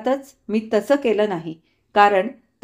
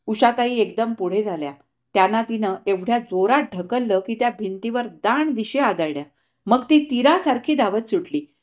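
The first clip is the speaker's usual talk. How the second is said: ordinary delivery, nothing marked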